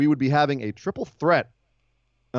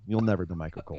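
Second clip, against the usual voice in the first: lower, softer teasing repeat of "You'll never be Michael Cole"; mock deep aside; 7 semitones lower, 8 dB quieter, faster than usual. Repeated tease, deeper aside.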